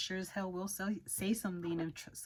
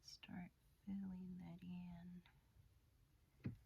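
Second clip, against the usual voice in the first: slow, much softer whisper while working; focused whisper; 17 dB quieter, slower than usual. Real, not acted. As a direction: barely breathe it out, absorbed in the task. Whispering while filling brows.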